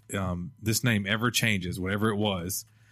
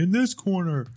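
booming ring announcer call; hype man voice; 10 semitones higher, 4 dB louder than usual. Shown as a demonstration. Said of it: "it's like the ... that thing".

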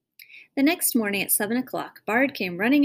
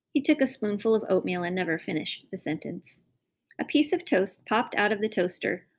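ordinary delivery, nothing marked

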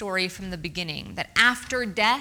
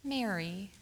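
tender; 2 semitones lower, 9 dB quieter than usual. Acted. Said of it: "He said".

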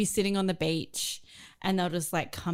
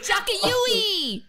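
high, loud, drawn-out Bogan yell; Bogan accent; 6 dB louder, much slower than usual. Shown as a demonstration. Trying classic Bogan sentences on laughing co-hosts, Bogan accent. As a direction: call it out slowly in broad Bogan Australian. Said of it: "how to do a Bogan accent".

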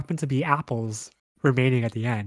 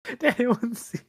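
mock embarrassed girl; 8 semitones higher, 4 dB louder than usual. Fallen girl quoted asking anxiously.

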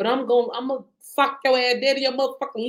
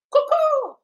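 high, louder cuckoo call; bird call; 5 dB louder than usual. Pretend. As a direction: sing it out like a cuckoo. Imitates a bird set free.

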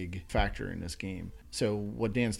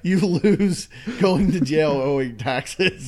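laughing; 8 semitones higher, 10 dB louder, slower than usual. Real, not acted. Laughing at the Monopoly joke.

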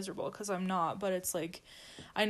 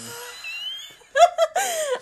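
high laugh; 13 dB louder than usual. Real, not acted. Big laugh.

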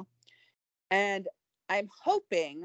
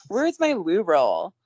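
slow, loud echo of the loo roll line; slow parody of a British phrase; echoed British quote; 9 dB louder, slower than usual. Pretend. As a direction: repeat it back in a put-on British voice. Repeats the line back.

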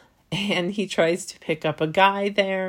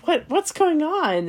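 higher, baffled question; confused, baffled; 10 semitones higher, 3 dB louder than usual. Retold or imitated. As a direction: play the gym guy thrown and lost. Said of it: "so confused".

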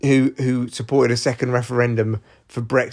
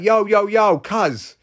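higher mock street accent, warning; put-on London street slang, pitched up; Europe; 4 semitones higher than usual. Europe warning Russia, in a silly street accent. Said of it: "this is me using silly voices".

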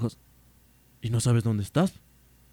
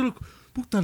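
ordinary talk, nothing marked

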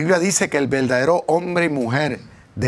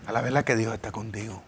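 quiet self-answer; 4 semitones lower, 7 dB quieter than usual. Retold or imitated. Repeats self-talk, softer.

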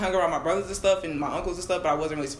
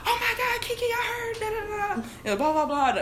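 high, gushing mock relative. She mocks family members who called about her coming out. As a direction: parody the nosy relatives calling her.

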